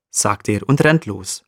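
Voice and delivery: usual speaking voice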